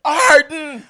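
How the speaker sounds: loud, high, insistent repetition; insistent shout. Real, not acted. Insisting in pronunciation argument.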